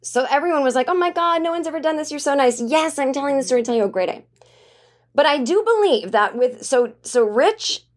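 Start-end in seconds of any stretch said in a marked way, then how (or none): 0.9-2.6 higher-pitched gush of admiration; hammed-up amazed praise; mock gushing. Quoting everyone's gushing praise.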